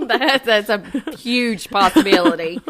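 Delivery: said through a laugh; amused laughing voice. Real, not acted. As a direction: get the words out while laughing.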